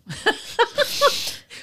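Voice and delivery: high burst of laughter. Real, not acted. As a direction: crack up laughing. Breaks up laughing.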